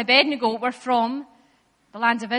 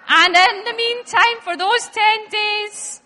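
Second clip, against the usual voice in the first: grand announcement; 5 semitones higher, 5 dB louder, slower than usual. Pretend.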